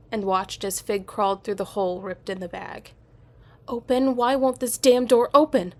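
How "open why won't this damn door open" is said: high, loud frantic shout; panicked yelling. A narrator's reading. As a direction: yell at the stuck door. Trapped, door won't open.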